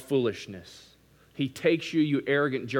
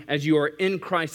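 ordinary delivery

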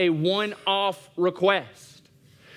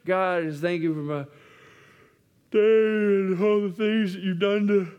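drowsy, trailing bedtime prayer; a hint of a sleepy half-hearted prayer. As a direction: mumble it like you're nodding off. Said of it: "before we accidentally fall asleep".

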